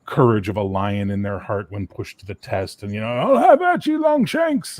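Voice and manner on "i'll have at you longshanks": high, loud hammed-up Scottish yell; Scottish war cry; mock Scottish battle cry. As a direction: bellow a defiant challenge in a put-on Scottish brogue. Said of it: "and all that fun stuff".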